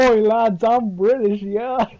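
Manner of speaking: hammed-up British shout-out, laddish and cheeky; mock British accent. Teasing a friend taken for British, mock British accent.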